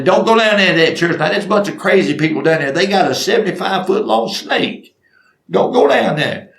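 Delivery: high, hurried mock warning; alarmed gossip warning. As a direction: imitate an alarmed lady spreading word fast. Ladies warning church members by phone.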